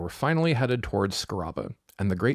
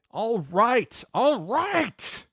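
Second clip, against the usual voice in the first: high-pitched cry of surrender; exasperated giving in; exasperated outburst; 13 semitones higher, much slower than usual. A narrator's reading. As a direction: cut in, fed up, voice climbing. Exasperated Pu Yi interrupts and gives in.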